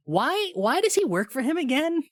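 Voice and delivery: exasperated question. Retold or imitated. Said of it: "I'm thinking".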